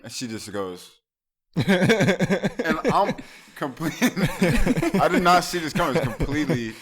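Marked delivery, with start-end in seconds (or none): 1.6-6.7 loud, high, breaking into laughter; laughing hard. Real, not acted. Storyteller recalling being caught off guard, loud laughing delivery. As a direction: tell it while cracking up. Cracking up while telling the story.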